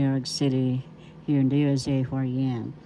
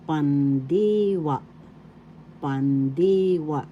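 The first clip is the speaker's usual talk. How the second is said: pandiwa with a long, raised DI; stress on second syllable; slower than usual. Shown as a demonstration. Teacher demonstrating a Tagalog word, stress on pandiwa's second syllable. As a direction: stretch the middle of pandiwa, pan-DEE-wa. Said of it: "The accent is in the second syllable".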